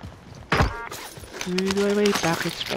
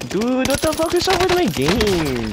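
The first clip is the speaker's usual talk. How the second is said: exasperated high outcry; 11 semitones higher than usual. Real, not acted. Game lagging, exasperated outcry.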